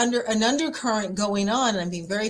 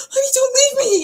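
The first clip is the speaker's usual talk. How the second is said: frightened pleading; 7 dB louder, faster than usual. Retold or imitated. Begging husband during a storm.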